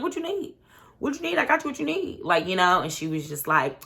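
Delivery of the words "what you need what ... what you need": mocking high voice. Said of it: "And he was like".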